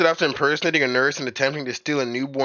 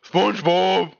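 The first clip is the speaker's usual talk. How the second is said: higher cartoon sidekick voice; Patrick Star; 6 semitones higher, slower than usual. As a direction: imitate a cartoon starfish calling his friend. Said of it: "You sound like Patrick Star".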